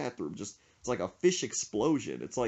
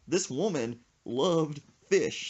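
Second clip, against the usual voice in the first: ordinary talk, nothing marked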